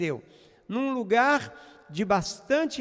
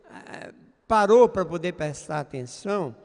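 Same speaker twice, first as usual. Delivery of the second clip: ordinary talk, nothing marked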